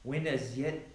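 fervent Bible reading swelling into preaching; scripture reading. Acted. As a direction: proclaim the psalm like a preacher building fervor. Reads Psalm 139 aloud.

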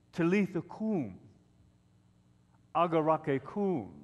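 "Talitha cum, agarake cum" sounded out clearly, phrase by phrase; pronunciation demonstration. Shown as a demonstration. Preacher teaching the congregation to say "Talitha cum, agarake cum", demonstrating. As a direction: model "Talitha cum, agarake cum" for others to repeat, pausing between phrases. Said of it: "you need to learn how to say".

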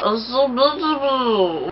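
high, soft and slow admiration; a soft slow high coo. Real, not acted. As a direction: coo over the look. Admiring the finished look.

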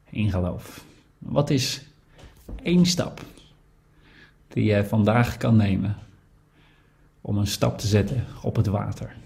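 guided reflection. Acted. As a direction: invite the congregation to listen inwardly. Leads a quiet reflection. (stille overdenking, zacht en traag)

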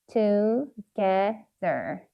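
"together" slowly split as to, ge, ther; syllable by syllable. Shown as a demonstration. Teacher sounding out "together" for learners, syllable by syllable. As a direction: pause between each syllable of "together". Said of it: "R 사운드 기억하시죠".

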